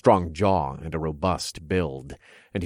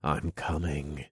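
echoing shadow voice; 3 semitones lower, 7 dB quieter, slower than usual. Acted. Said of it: "my shadow's voice echoed".

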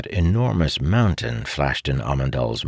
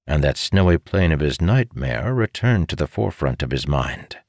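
plain reading voice

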